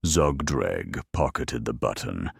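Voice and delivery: usual speaking voice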